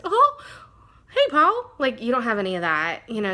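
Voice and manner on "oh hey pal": high, loud squeaky cartoon greeting; Mickey. Vlogger showing how stylized Mickey talks, high cartoon impression. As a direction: pitch it way up into a cartoon falsetto. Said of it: "she's not like Mickey where, you know, he is also very stylized".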